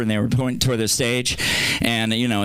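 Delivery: ordinary delivery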